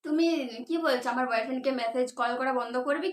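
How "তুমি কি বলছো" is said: normal talking voice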